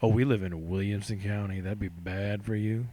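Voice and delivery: a lightly voiced menacing warning. Mock threat from the county.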